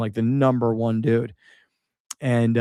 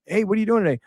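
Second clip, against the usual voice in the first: quoted question; 8 semitones higher, much faster than usual. Retold or imitated. Quoted morning exchange.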